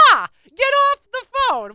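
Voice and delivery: high, loud, drawn-out mock yell; yelling at mother. Daughter yelling at her mother in a store, exasperated yell. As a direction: holler it, stretching the words. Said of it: "She's yelling at her mother".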